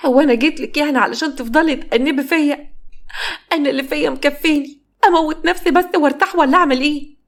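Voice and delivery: high, despairing cry; despairing outburst. Acted. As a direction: lash out in hurt despair. Hurt daughter lashing out.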